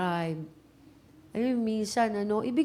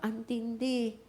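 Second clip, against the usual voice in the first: ordinary talk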